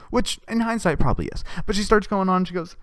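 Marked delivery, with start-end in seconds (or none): none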